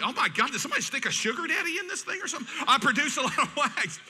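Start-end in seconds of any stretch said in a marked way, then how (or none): 0.0-2.5 high, quick mock shock; mock disgust. Listener seeing earwax in AirPods, high mock shock. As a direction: gasp it out in fake horror.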